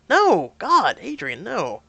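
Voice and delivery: loud, high exclamation; emphatic denial. Acted. Man denies disrespecting partner, loud emphatic protest. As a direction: protest forcefully, appalled.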